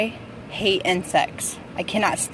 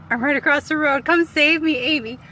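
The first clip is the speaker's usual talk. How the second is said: calling for help; 7 semitones higher than usual. Real, not acted. Calling to Amy across the road to come save her. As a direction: call out to a friend for rescue.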